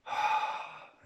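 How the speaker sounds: long audible breathy exhale; demonstrated release of breath; letting-go sigh. Shown as a demonstration.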